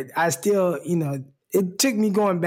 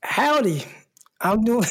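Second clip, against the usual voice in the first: Southern howdy; 5 semitones higher than usual. Retold or imitated. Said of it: "You're already picking up your Southern stuff?".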